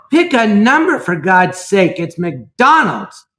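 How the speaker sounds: loud, slowed-down frustrated yell; retold exasperated yell. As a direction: re-enact yelling at a slow customer. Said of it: "I yelled".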